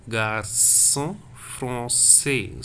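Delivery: "garçon français" said very slowly with a soft ç; slow French demo. Shown as a demonstration. Teacher slowly modelling the cedilla for the class. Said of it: "soft C".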